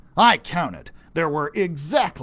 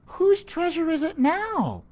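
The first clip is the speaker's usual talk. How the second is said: put-on higher child's voice; girl's voice; 12 semitones higher than usual. Anna asks the old lady, higher voice. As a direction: ask it as a curious girl. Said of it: "Anna asked".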